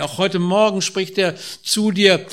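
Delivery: ordinary talk, nothing marked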